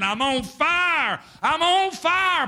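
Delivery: slow, high, rising anguished cries; retold scream. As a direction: cry out as the burning man.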